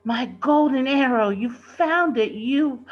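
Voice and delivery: high, loud delighted cry; light up at seeing the arrow; overjoyed lord greeting his treasure; joyously. A narrator's reading.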